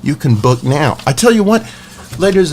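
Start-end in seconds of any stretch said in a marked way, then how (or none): 1.1-2.5 excited. Real, not acted. Host excitedly announcing giveaway.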